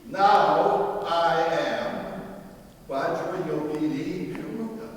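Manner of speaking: loud, slow, declaiming chant; rolling dactylic verse. Shown as a demonstration. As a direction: proclaim it like a sacred poem, building to a loud cry. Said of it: "It goes like this".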